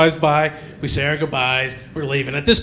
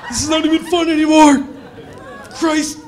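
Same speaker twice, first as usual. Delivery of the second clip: mock panic shout; 9 semitones higher than usual. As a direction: shout it in comic alarm.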